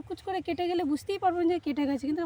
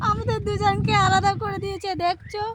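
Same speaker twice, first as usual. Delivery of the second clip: louder, higher complaint; called out; 5 semitones higher, 5 dB louder than usual. Real, not acted. Girl loudly calling out that mother left them apart. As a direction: call it out across the field. Called out, then said plainly again.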